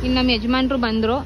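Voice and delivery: ordinary talk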